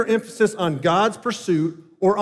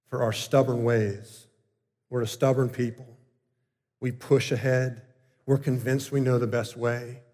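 subdued, lower-pitched prayer voice; closing prayer; 4 semitones lower than usual. Real, not acted. Preacher leads congregation asking God's forgiveness, subdued prayer. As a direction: speak humbly to God, with long pauses at first.

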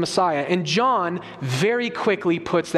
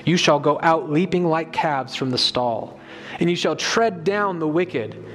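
scripture reading; 4 semitones lower than usual. Acted. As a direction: proclaim the prophecy with weight. Reads Malachi aloud.

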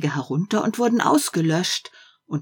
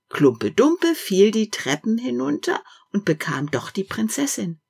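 plain reading voice

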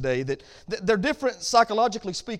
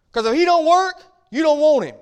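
louder, higher punch on work; preaching at full force; 7 semitones higher, 9 dB louder than usual. Real, not acted. Pastor warning ladies about lazy mates, loud preaching. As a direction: drive the warning home to the ladies.

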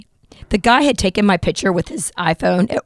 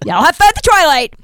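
sarcastic farewell; 7 semitones higher, 4 dB louder than usual. Retold or imitated. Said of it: "sarcastic parting shot".

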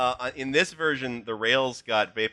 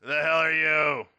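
cranky, pitched higher; 5 semitones higher than usual. Acted. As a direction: snap it like a cranky station guard. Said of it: "you hear a, a cranky voice".

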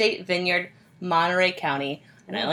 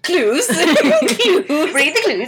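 laughing mockery; 7 semitones higher, 6 dB louder, much slower than usual. Real, not acted. Mocking friend's phrase.

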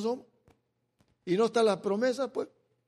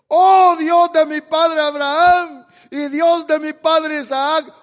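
loud, slow, tearful appeal raised high; anguished prayer; 8 semitones higher, 12 dB louder, slower than usual. Acted. Jacob in anguish calling on his fathers' God, loud tearful cry. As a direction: pray like a man in anguish, voice breaking.

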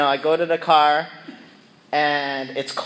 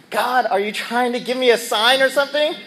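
exasperated plea to God; 9 semitones higher, 3 dB louder than usual. Retold or imitated. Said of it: "I'm like".

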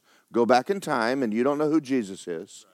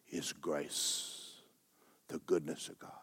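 hushed whisper; 8 semitones lower, 14 dB quieter, slower than usual. Real, not acted. Preacher recalling sitting in church, hushed whisper. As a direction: whisper it reverently.

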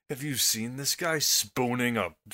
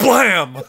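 gunshot sound; 7 semitones higher, 10 dB louder than usual. Pretend. Mock gunshot after JFK quote.

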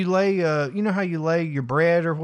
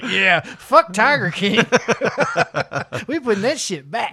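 laughing; 4 semitones higher than usual. Retold or imitated. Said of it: "I was like".

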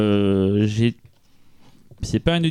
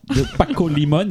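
names said the Spanish way, a bit higher; Spanish accent; 8 semitones higher than usual. Retold or imitated. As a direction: lean into the Spanish accent on the name. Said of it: "mon accent espagnol".

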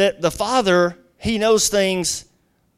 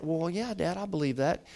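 much softer daughter's reply; meek agreement; 11 dB quieter than usual. Retold or imitated. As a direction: give in quietly, as the retold daughter. Daughter meekly answers her father.

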